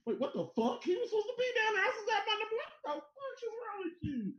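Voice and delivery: high, fast, whiny mock outrage; imitating DeAndre Hopkins. Mock DeAndre Hopkins complaining to friends, high and whiny. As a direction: squeal it like a baffled receiver. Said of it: "DeAndre Hopkins go, like".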